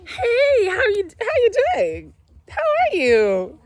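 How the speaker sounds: high, loud, teary put-on greeting voice; over-the-top greeting. Mom greeting viewers in a hammed-up teary voice. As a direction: gush hello in a mock-weepy voice. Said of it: "Me have to talk like this from now on".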